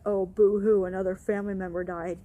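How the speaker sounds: loud sneering boo-hoo, then a quick rattle; mock his fake pity, loud on boo-hoo. Coworker mocking her grief, loud sarcastic sneer. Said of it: "who was like".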